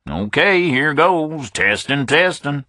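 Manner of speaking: put-on Southern accent, jokingly testing the line; a Southern caller hams up a signal test; playful southern mic check. A narrator's reading. Hubble plays along, mic check.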